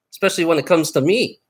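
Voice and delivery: ordinary delivery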